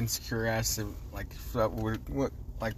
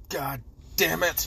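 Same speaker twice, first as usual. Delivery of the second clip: acted exasperation; 6 semitones higher, slower than usual. Co-host joins the sunburn tirade, mock angry cursing. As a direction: curse slowly in mock outrage. Plays along with mock outrage.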